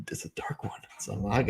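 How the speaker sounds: breathy whispered aside; whispering. Real, not acted. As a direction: whisper it under your breath. Low aside while pouring beer.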